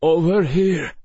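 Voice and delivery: put-on faint call with a light Russian accent; weak Russian accent. A narrator's reading. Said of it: "The voice was weak, but familiar, the accent Russian".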